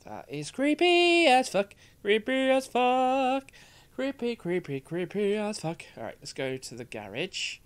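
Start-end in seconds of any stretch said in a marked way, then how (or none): 0.1-5.8 creeped out. Real, not acted. Reacting to creepy scene.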